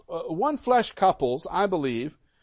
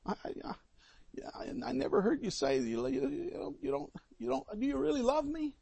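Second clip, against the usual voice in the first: higher, softer plea of a doubting wife; wife's complaint; 5 semitones higher, 13 dB quieter, faster than usual. Retold or imitated. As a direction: lightly play the wife pleading for reassurance. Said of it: "the woman who comes to her husband and says".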